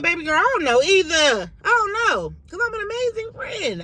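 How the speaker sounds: high, loud playful exasperation; sassy inner retort. Retold or imitated. Telling her friend she has no idea why, in a high sassy voice. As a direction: toss it off with mock indignation. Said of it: "And I'm thinking, like".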